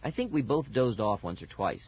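ordinary delivery

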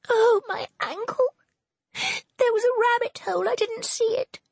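a hammed-up wail of pain. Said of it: "wailed Serena".

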